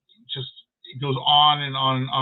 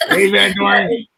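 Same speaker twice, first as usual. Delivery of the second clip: excited greeting; 6 semitones higher, 10 dB louder, slower than usual. Real, not acted. Host greets guest loudly.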